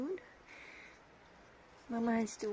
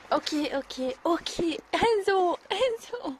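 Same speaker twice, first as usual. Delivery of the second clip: high, loud exasperated calling; 7 semitones higher, 8 dB louder than usual. Real, not acted. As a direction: call him off, rising exasperation. Scolding overexcited dog.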